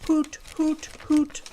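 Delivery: high playful hoots; owl hooting. Shown as a demonstration. Little pig happily hooting, high. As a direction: hoot away for fun.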